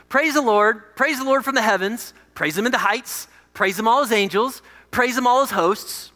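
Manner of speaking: exultant scripture reading in measured phrases; psalm proclamation. Acted. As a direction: proclaim each line as a ringing summons. Reads psalm of praise aloud.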